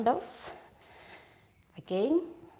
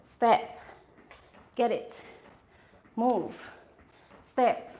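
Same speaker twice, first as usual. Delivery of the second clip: punchy coach calls over music; halftime pep speech. Real, not acted. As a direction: bark out each move to the class. Instructor calling workout cues.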